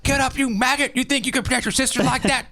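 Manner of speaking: high, barking drill sergeant voice. Retold or imitated. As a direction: scream orders at a recruit like a drill sergeant. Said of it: "This is the drill sergeant coming out".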